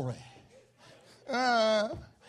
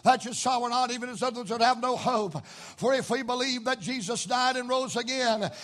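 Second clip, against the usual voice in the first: rhythmic preacher reading scripture aloud; 4 semitones higher, 4 dB louder than usual. Acted. Preacher reading scripture to the congregation, measured cadence. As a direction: read the verses with a preacher's cadence.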